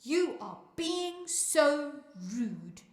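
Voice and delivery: slow, loud telling-off; scolding. A narrator's reading. Mama reproaches Naledi for rudeness at dinner, scolding slowly. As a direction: stretch out each word in reproach. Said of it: "Mama scolds".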